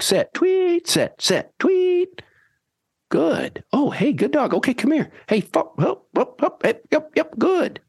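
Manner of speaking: high calls, whistle tweets, then high happy praise; whistle and sit commands into praise. Shown as a demonstration. Trainer demonstrates the drill, commands then high praise. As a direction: run the whole stop-and-praise drill. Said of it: "walking out quickly towards that dog".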